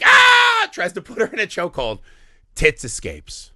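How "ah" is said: a very high, much louder mock shriek; imitated scream. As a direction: scream like she's flying at him. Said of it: "She's like".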